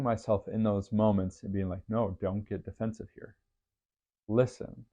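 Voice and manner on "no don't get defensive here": normal talking voice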